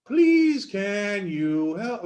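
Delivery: high, slow, long whale call; speaking whale. Acted. Panel story of Ellen speaking whale, drawn-out whale voice. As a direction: stretch it out like a whale song. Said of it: "she was speaking whale".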